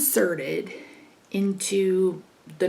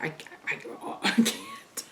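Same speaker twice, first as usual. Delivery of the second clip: whisper; 5 dB quieter than usual. Retold or imitated. Exhausted after a hundred shocks.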